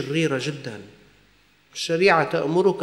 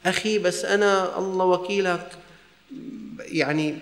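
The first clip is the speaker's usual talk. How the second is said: normal talking voice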